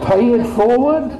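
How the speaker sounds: high, loud "pay it forward" through laughter; laughing scoff. Real, not acted. Preacher scoffing at the pay-it-forward idea, laughing. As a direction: laugh the phrase off as absurd. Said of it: "They call that a mortgage".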